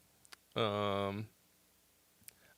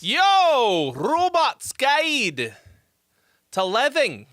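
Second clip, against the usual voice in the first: stretched, higher, louder show announcement; hype title call; 8 semitones higher, 4 dB louder, much slower than usual. Acted. Streamer opening his show with a big host call. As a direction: belt out the show's name like a host.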